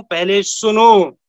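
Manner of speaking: slow, weighted advice; slowed right down to drive the point home; slow emphasis. Shown as a demonstration. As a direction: lay each word down slowly. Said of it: "इसीलिए मैं बोलता हूं".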